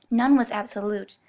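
plain reading voice

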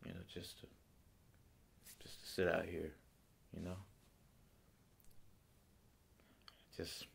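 quiet, halting, weary grief, long pauses; grieving father monologue. Acted. Grieving father confiding in Dave about his daughter, subdued and halting. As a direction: hold the grief in, softly, words trailing off. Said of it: "doing a monologue from the movie Mystic River".

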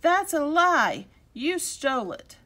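loud, high, drawn-out accusation; angry accusation. Acted. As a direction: fling the accusation at him. Boys accuse the old gentleman.

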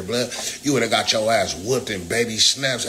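normal talking voice

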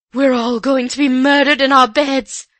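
loud, high, terrified wail; terrified quaver. A narrator's reading. Housekeeper huddled with two girls, terrified wail. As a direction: wail it in terror. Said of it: "she quavered".

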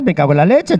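normal talking voice

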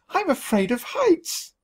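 high, slow fearful confession; frightened. A narrator's reading. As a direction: admit it, terrified of heights. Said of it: "I stammered".

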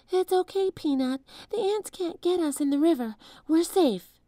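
a hint of comforting a mouse; soothing a pet. A narrator's reading.

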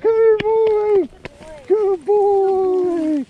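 high, slow, drawn-out praise; sing-song dog praise. Real, not acted. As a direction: coo it to the dog.

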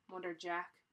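ordinary delivery

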